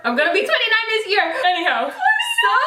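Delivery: high, fast embarrassed laugh through the words; giggling admission; laughing, flustered. Real, not acted. Flustered about her age.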